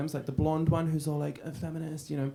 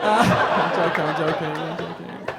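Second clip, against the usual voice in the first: ordinary delivery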